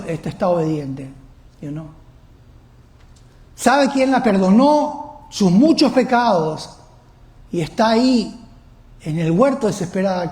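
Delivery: ordinary talk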